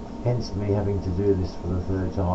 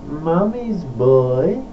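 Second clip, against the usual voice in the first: drawn-out title with a rising end; title announcement; 6 semitones higher, 5 dB louder, much slower than usual. Real, not acted. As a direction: announce it slowly, stretching each word upward.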